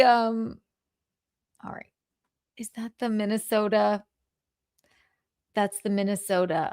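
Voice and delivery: ordinary talk, nothing marked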